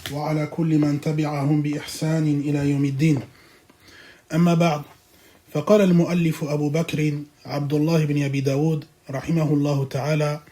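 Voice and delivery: low, soft, rapid formal reading; quiet, quick Arabic lecture opening; formal Arabic recitation. Acted.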